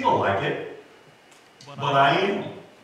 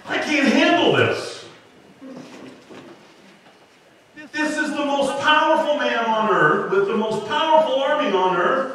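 panicked Moses; 10 semitones higher, 4 dB louder than usual. Retold or imitated. Preacher voices panicked Moses.

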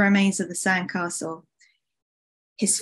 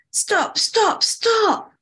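high-pitched repeated cries; desperate shout; 12 semitones higher, much slower than usual. Acted. Summer begs the wave to stop, desperate high cries. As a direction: cry each word out high and urgent. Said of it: "shouted Summer".

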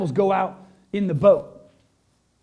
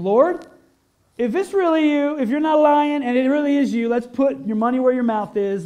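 high, loud, pleading challenge; calling out; 6 semitones higher, 6 dB louder than usual. Acted. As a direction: call across the water to test him. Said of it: "Then Peter called to him".

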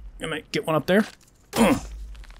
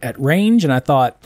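ordinary delivery

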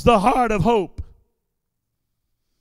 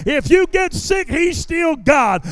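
preaching at full force; 3 semitones higher, 6 dB louder than usual. Acted. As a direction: drive the promise home to the congregation. Climax of sermon.

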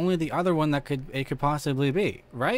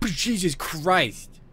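drawn-out startled oath, higher; 4 semitones higher, 4 dB louder, much slower than usual. Real, not acted. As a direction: exclaim in shock, stretching it out slowly. Reacts to jump scare.

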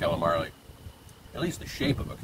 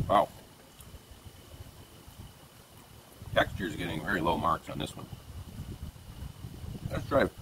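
talking with food in mouth; mumbling between chews; chewing while talking. Real, not acted. Seen 1: bite taken at -5; jaw keeps working while he talks.

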